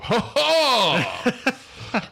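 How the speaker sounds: big, forced belly laugh; high, hammed-up "ha... ha!"; laugh with gusto. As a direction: burst out in exaggerated hearty laughter. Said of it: "I'll laugh with more gusto".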